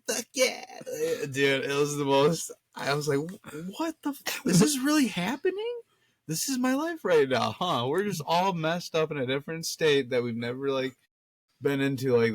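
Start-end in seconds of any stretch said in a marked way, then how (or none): 0.1-0.6 high-pitched retold whoop; high, excited cheer at ordinary volume; mock triumphant cheer. Acting friend cheering atop tower. 3.8-7.8 high-pitched, incredulous retelling; mock can't-believe-it squeal; acted disbelief. Retold disbelief at friend climbing.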